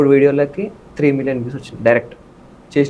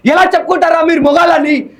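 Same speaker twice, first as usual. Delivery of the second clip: much higher, louder furious shout; hero shout; 11 semitones higher, 6 dB louder, slower than usual. Shown as a demonstration. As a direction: roar the challenge at the enemies. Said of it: "ఇప్పుడు కూర్చొని చెప్పాలంటే".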